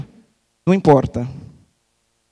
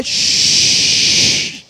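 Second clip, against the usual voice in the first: hushing shh. Real, not acted. Hushing the crowd.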